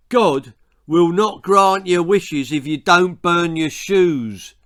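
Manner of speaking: loud, menacing warning; threatening. A narrator's reading. Messenger threatens the villagers, loud and menacing. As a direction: lean in with a threat. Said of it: "threatened the heavenly messenger".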